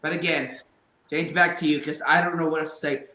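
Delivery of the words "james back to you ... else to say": ordinary talk, nothing marked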